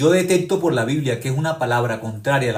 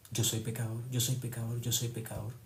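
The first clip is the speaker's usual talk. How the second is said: much softer, hopeless self-accusation; despairing Judas; 11 dB quieter than usual. Retold or imitated. Preacher voicing Judas believing he is lost, soft and despairing. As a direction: say it like a broken man giving in.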